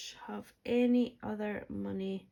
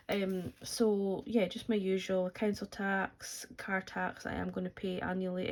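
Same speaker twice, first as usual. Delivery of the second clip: normal talking voice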